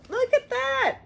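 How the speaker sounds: high delighted squeal; excited high-pitched look at that. Real, not acted. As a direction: squeal over something adorable. Squealing at cute appliqué.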